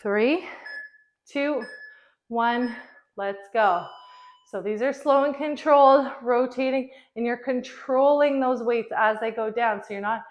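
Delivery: loud, pumped-up trainer cues; workout coach calling. Acted. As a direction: call the reps over the music. Calls out the workout over music.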